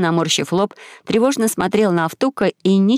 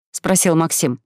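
usual speaking voice

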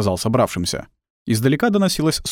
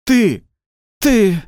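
короткие громкие высокие выкрики; окликни криком, из последних сил; отчаянный крик; 12 semitones higher, 6 dB louder than usual. A narrator's reading. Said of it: "закричал он". (отчаянный окрик)

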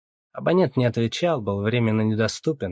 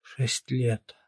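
hushed, shocked echo; gasped dismay; 3 semitones higher, 3 dB quieter than usual. A narrator's reading. Mother hearing her son's possible sentence, gasping. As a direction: repeat it faintly, aghast. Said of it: "ахнула Ирина Фёдоровна".

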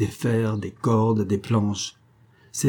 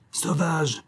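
higher-pitched indignant exclamation; exclaimed; 6 semitones higher, 4 dB quieter than usual. A narrator's reading. Major to the men who refuse him, exclaimed. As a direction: cry out in indignation, without real volume. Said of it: "s'écria-t-il".

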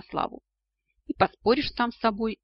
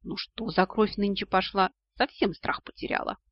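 ordinary delivery

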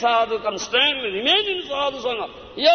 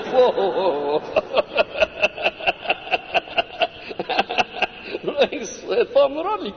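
laughing delight; 2 semitones higher than usual. Real, not acted. Delighted laughing over Krishna's flute.

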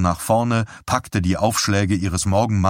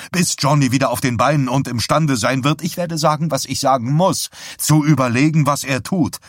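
raised, loud, driving firmness; forceful ultimatum; 6 semitones higher, 3 dB louder than usual. A narrator's reading. Husband forbids wife talk of God's plans, forceful. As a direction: lay down the law, voice rising.

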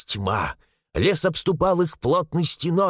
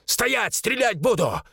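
high-pitched challenge, shouted at half volume; yelled warning; 6 semitones higher, slower than usual. A narrator's reading. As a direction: throw a sharp warning into the dark. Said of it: "заорал Вавилов в темноту". (высокий окрик вполсилы)

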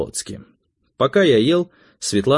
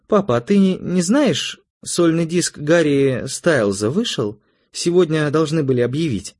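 put-on high, slowed girlish voice; pitched-up daughter, feeling out a question; teenage daughter, curious; 7 semitones higher than usual. A narrator's reading. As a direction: ask Dad hopefully as his daughter.

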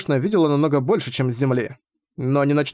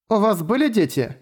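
put-on lightly higher child voice; a small curious girl asking; young girl voice; 7 semitones higher than usual. (голос маленькой девочки)

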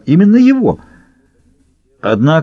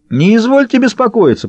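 high-pitched, eager-to-please voice; servile reassurance; 11 semitones higher than usual. A narrator's reading. Уйбуй tells the baron not to worry, servile. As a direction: soothe the boss, pitched right up.

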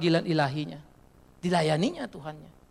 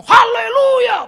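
much higher, louder, slow drawn-out hallelujah; ecstatic hallelujah; 14 dB louder, much slower than usual. Retold or imitated. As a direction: stretch it like a fervent worshipper. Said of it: "Kita ngomong". (Haleluya panjang, tinggi dan keras seperti jemaat yang berapi-api)

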